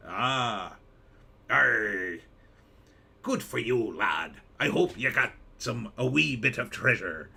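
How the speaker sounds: put-on playful pirate accent, growling the arr. Streamer answering a viewer's pirate ancestry in a pirate accent. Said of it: "I like to talk in a pirate voice".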